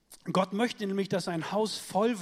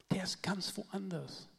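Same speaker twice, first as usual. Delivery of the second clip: softer and slower; 7 dB quieter, slower than usual. Shown as a demonstration. Preacher softly repeating to the congregation, quiet and slow. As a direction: drop to a soft, slow repeat of "Der ist ganz woanders".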